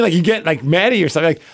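normal talking voice

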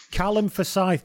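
amateur Scottish accent, drawn out slowly; attempted Scottish accent. Shown as a demonstration. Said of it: "good name to say in a Scottish accent".

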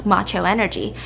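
usual speaking voice

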